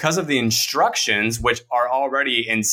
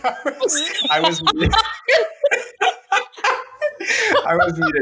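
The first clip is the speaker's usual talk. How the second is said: laughing. Real, not acted. Laughs at being muted.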